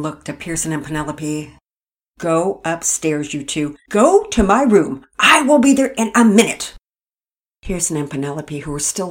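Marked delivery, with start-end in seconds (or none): 2.2-6.7 put-on angry mother ordering her kids upstairs; stern order. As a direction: send them off sharply.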